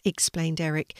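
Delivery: ordinary delivery, nothing marked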